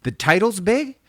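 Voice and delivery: high, rising hype, item by item; pumped producer. Retold or imitated. Retelling the producer selling the album cover, pumped. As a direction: mimic a thrilled record man ticking off selling points. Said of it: "He's so pumped".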